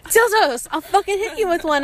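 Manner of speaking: hammed-up indignant yell; mock threat. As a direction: threaten them in comic outrage, pitched high. Acted mock threat to the fan.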